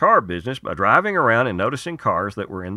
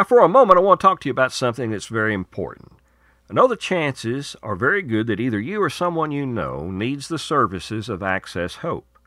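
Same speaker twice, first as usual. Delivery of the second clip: ordinary talk, nothing marked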